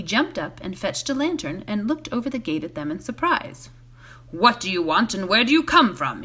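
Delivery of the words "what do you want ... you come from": a touch of gruffness, lightly voiced; gruff gatekeeper. A narrator's reading. Gatekeeper challenging hobbits at night, mildly gruff. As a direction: challenge the strangers at the gate. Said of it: "he asked gruffly".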